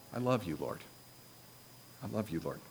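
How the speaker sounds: hushed, low prayer of love; soft, low, heartfelt address to God; tender prayer. Acted. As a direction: murmur it softly and low to the Lord. Models a simple prayer to God.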